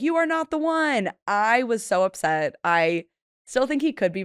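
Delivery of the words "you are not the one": Maury reveal. Retold or imitated. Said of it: "Maury comes out and he's like".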